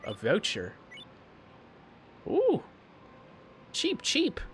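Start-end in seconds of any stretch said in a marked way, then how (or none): 2.3-4.4 squeaky voice. Pretend. Squeaky reaction to voucher.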